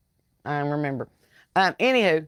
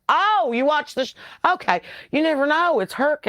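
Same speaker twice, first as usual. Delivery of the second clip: mock surprise; 9 semitones higher than usual. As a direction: caught off guard, pitched much higher. Retelling meeting a fan.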